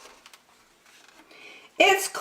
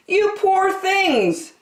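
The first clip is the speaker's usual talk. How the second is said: high and slow, full of sympathy; Percy's pity; 14 semitones higher, slower than usual. A narrator's reading. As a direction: coo over the poor cold animals. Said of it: "said Percy".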